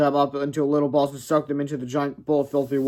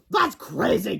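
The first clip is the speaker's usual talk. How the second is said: higher, sneering bully; mocking scoff; 7 semitones higher, slower than usual. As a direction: laugh off the story. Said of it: "Kipper yelled".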